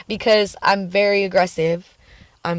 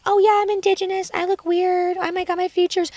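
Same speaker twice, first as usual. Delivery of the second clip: high, put-on whining voice; mock insecure self; 12 semitones higher than usual. Vlogger parodying her old insecure self, high and whiny. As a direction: parody a self-pitying girl.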